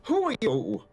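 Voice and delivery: put-on high, suspicious old woman; surly old barmaid. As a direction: demand who they are, high. Barmaid Dana questions the stranger.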